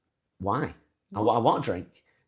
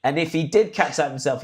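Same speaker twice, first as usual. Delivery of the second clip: ordinary delivery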